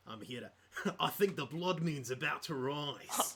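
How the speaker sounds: slow, broad Australian character voice; Tracker. Acted. Player as changeling personality, slow Australian accent. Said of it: "one of them was Tracker".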